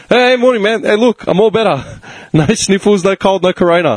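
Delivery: high, bright mock-cheerful greeting; cheery boss greeting. Boss greeting the coworker, mock cheery. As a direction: play the boss breezing past all friendly. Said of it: "He's like".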